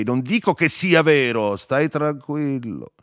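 loud and high, slowing at the end; shouting. A narrator's reading. Narrator tells Firmo to stay calm, shouting. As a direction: shout it, then stretch out the last words. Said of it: "gli gridai".

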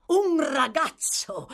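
high, loud, drawn-out exclamation; exclaiming. A narrator's reading. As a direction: cry out in astonishment. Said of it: "esclamò l'ebreo".

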